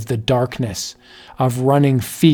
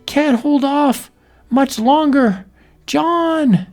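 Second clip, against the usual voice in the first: high, desperate cries for John; holding off the beast, shrieking for husband; screaming for help; 13 semitones higher, much slower than usual. Acted. Wife attacked, screams for John.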